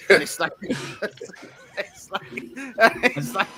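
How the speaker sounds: laughing high and slow. Real, not acted. To friends, laughing in disbelief. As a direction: giggle through the words.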